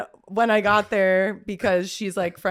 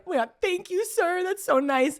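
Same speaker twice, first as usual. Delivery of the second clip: gushing thanks; 10 semitones higher than usual. Retold or imitated. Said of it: "we were like".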